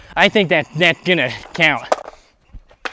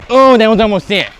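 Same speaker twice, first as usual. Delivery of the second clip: excited; 5 semitones higher, 9 dB louder than usual. Real, not acted. Near miss on a skate trick.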